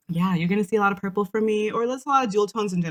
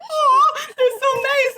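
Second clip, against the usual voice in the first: charmed; 5 dB louder, slower than usual. Retold or imitated. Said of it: "we're just like".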